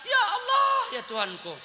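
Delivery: high, whiny lament over his wife; hammed-up husband complaining about a scruffy wife; mock exasperated husband. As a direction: pitch it up like a fed-up husband groaning. Husband mocks wife at home.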